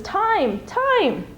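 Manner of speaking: very high, loud panicked squeal; sudden squeal. Acted. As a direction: squeak it out in terror. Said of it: "in a sudden squeal".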